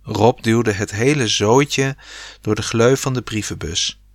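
plain reading voice

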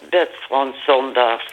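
ordinary talk

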